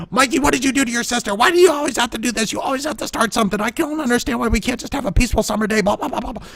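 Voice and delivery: fast, high scolding mother; exasperated mom nagging, sped up. Retold or imitated. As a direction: rattle off mom's lecture quickly. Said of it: "here comes Mom walking up the stairs".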